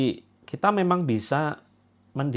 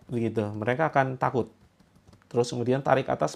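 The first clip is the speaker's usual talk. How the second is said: ordinary talk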